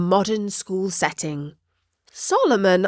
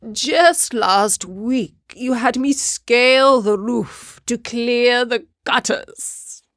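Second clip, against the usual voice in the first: higher, slow, put-upon protest; aggrieved cat; 4 semitones higher, 3 dB louder, slower than usual. A narrator's reading. Solomon the cat protests climbing the roof for gutters, aggrieved. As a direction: stretch out the grievance indignantly.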